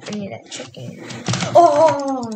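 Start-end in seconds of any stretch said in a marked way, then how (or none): 1.6-2.3 sudden loud startled oh; startled yelp. Real, not acted. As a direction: jump at the scare. Startled by a creeper.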